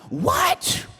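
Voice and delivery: squeaky high shocked parent; mock parent gasping at the news; hammed-up alarmed parent shriek; shocked what. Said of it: "don't respond".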